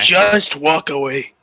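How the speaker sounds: put-on slow, Scottish-accented warning. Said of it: "did I go Scottish there?".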